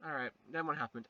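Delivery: ordinary delivery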